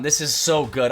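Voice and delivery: ordinary delivery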